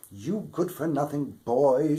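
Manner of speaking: loud scolding mother; shouting mother. A narrator's reading. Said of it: "she shouted".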